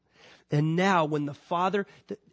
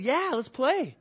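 higher, eager dog voice; voicing the excited dog; 8 semitones higher, slower than usual. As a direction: play the thrilled puppy. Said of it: "He just gets all excited".

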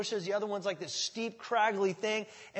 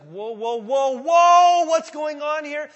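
loud, higher startled whoa; alarmed shout; 8 semitones higher, 12 dB louder, slower than usual. Acted. Philistines panicking at the slaughter, loud higher shout. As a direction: panic as your men fall.

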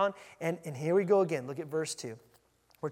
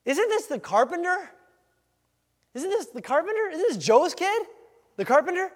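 high, loud disbelieving questions; acted scoffing disbelief, pitched right up; incredulous townspeople; 9 semitones higher, 6 dB louder than usual. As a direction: question it as a scandalised local. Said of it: "They're like".

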